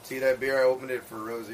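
ordinary talk

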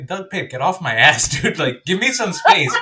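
loud and high, mock annoyed at a clingy friend; retelling telling a clingy friend to back off, loud and exasperated; exasperated outburst. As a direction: shove him off with your voice, half laughing. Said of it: "I was like".

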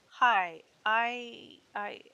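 nervous halting start, repeating I; stammering. A narrator's reading. Pledge stammering to the sorority girl at the door. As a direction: trip over the first words, flustered. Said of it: "I stammered".

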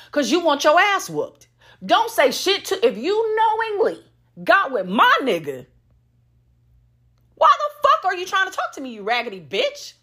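firing off the question in outrage; angry rant. Real, not acted. Ranting at side woman.

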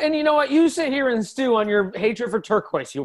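acted anger. Painter storming off from partner, high indignant scolding. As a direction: raise the pitch and scold.

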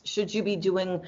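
usual speaking voice

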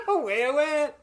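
high, loud laughter over the words; real laugh, high. Real, not acted.

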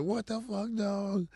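high startled cry, held back in volume. Retold or imitated. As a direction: sound caught off guard by a tackle. Retold being tackled.

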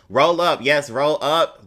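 loud cheer. Real, not acted. Cheering someone joining.

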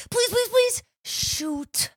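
put-on high, softened child's pleading; pleading kid. Recalling herself as a kid, high pleading voice. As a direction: beg like a hopeful kid, then deflate. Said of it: "I used to, as a kid, be like".

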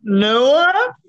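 high, bright excited hello; excited greeting. Real, not acted. Excited reunion greeting on podcast.

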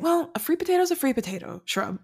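quick, high-pitched mock matter-of-fact reply; imitated retort. Podcaster voices Kelly answering Shrub about potatoes, high mock retort. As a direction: toss it back high and fast.

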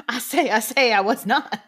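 usual speaking voice

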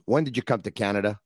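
ordinary talk

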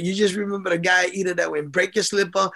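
ordinary delivery

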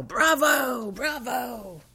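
high, bright cheering bravo, second one fading; mock bravo yell. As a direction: imitate dad jumping up to cheer the cast. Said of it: "performance and yell".

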